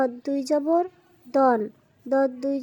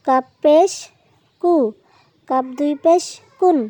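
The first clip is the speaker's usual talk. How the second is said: spelling out "কাফ জবর কা" syllable by syllable, louder, in a chanting drill; loud recitation drill; 7 dB louder than usual. Shown as a demonstration. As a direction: drill the class aloud, letter by letter. (উচ্চস্বরে সুর করে বানান পড়ানো)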